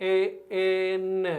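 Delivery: "AN" stretched out very slowly; slow pronunciation. Shown as a demonstration. Teacher showing the first sound of the abbreviation, very slowly. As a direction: draw out each sound of "AN", holding the final n. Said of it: "దీన్ని స్లోగా పలికితే ఏమొస్తుందో చెప్పండి".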